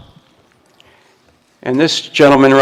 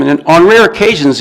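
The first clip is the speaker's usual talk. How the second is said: rare lifted higher and louder; stress on rare; 4 semitones higher, 4 dB louder than usual. Shown as a demonstration. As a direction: lean hard on "rare" in "on rare occasions". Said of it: "Emphasis on the word rare".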